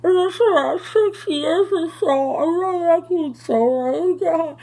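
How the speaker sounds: very high, loud, garbled answer; imitated mouth full. Patient answering how long sober, garbled mouth-full imitation. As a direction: unintelligible wail as if the dentist's gauze is in. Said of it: "and you can't answer".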